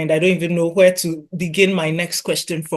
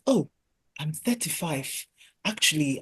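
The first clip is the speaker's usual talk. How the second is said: hushed realization; 2 semitones lower, 10 dB quieter than usual. Acted. Voices women's sudden realization.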